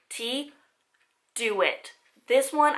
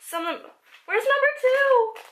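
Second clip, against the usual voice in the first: eager; 12 semitones higher, 5 dB louder than usual. Real, not acted. Searching the numbered packets.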